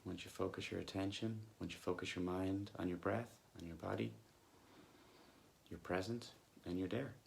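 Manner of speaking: soft, unhurried meditation voice with long pauses; guided meditation. Acted. Coach leading listeners back to body and breath, calm guided meditation. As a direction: gently guide them back to the breath, leaving long silences.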